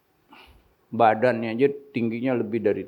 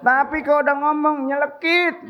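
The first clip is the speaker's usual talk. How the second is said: preaching punchline; 5 dB louder than usual. Acted.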